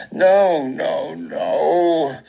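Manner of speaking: high, wailing refusal, loud at first; distressed denial. Acted. Patient reading test results on Google, distressed wail. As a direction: cry it out in mounting dread. Reliving horror at online search results.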